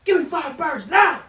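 full-force high-pitched bellow; angry shouted demand. Retold or imitated. Cook snapping back at coworker, retold angry shout. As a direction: yell the order, drawing out the last words. Retold impatient kitchen order, escalating.

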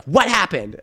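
imitating an alarmed mom's bark. Mom reacting to the school's phone call, barking.

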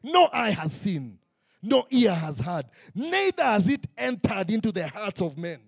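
loud, high, stretched-out proclamation; preaching at full force. Acted. Preacher declaring scripture to the church, full force. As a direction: ring it out over the congregation.

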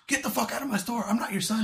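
high-pitched mock screaming; retold scream. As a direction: imitate a furious shopkeeper without full volume. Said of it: "he was screaming at".

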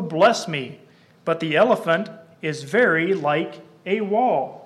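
slow, loud dramatic poem reading; poem recitation. Acted. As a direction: declaim the verses to the congregation. Recites the blind men poem.

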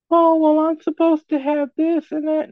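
high, loud mock whine of an entitled woman; mock spoiled princess voice; mocking whiny voice. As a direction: sneer it in a shrill put-on girly voice.

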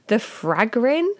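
"The fragrin?" drawn out very slowly with a rising, questioning tune; unsure pronunciation. Shown as a demonstration. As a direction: try "fragrin" slowly, asking if it's right. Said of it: "I'm gonna call it the fragrin".

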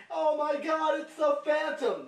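high, loud mock scream; hammed-up terrified shout; crowd screaming. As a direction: yell like a panicked audience. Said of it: "auditorium starts screaming".

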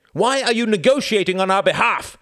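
high, loud, angry outburst; a president crying out in anger; heated cry. Acted. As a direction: fire it back heatedly. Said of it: "cried Bennis heatedly".

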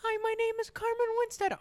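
very high, squeaky put-on voice; high-pitched comic character voice; Carmen Winstead. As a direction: introduce yourself in a tiny falsetto. Impersonation contrasted with deep voice.

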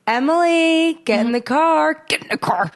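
imitating suppressed anger through tightened lips; a mom who can't yell, forcing it out; tight-lipped mom. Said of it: "the tightening of the lips".

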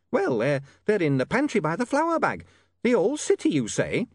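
high, scolding landlady voice; a prim landlady scolding a lodger; shrill landlady. A narrator's reading.

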